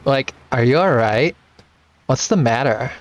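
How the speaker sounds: put-on Lena's girlish voice, lightly done. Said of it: "I have to do my Lena voice again".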